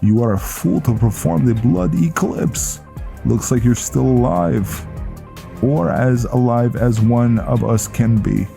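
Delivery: put-on lively character voice for an old comrade; Viking greeting a fallen knight. Viking chiding Velen for performing the blood eclipse, animated.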